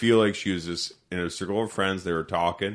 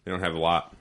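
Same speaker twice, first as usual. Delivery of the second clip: ordinary talk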